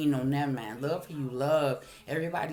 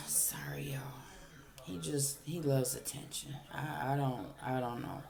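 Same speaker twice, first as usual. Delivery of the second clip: much softer, whispered apology to viewers; embarrassed aside; 4 semitones lower, 12 dB quieter than usual. Real, not acted. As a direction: drop to a hush and apologise. Apologises to viewers after interruption.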